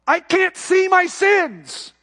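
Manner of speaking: high, slow, loud cry of frustration; frustrated shout. Retold or imitated. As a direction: shout it out, fed up. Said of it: "she shouted in Spanish".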